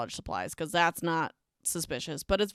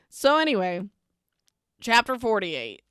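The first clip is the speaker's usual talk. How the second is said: ordinary talk, nothing marked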